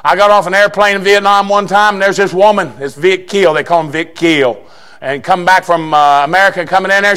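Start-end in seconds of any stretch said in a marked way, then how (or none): none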